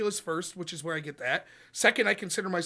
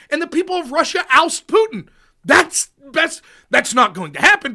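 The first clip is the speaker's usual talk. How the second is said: high, loud, vehement protest; retold outburst, pitched up and forceful; exasperated rebuttal; 7 semitones higher, 10 dB louder than usual. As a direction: argue back hotly, punching each phrase. Retells own exasperated rebuttal to accuser.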